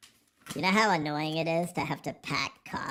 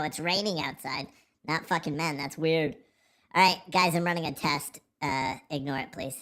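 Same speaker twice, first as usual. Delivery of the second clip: normal talking voice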